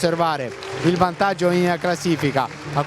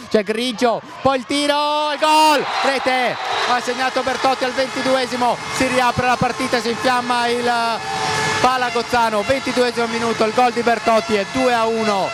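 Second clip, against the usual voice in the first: goal call; 6 semitones higher than usual. Real, not acted. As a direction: explode on the goal.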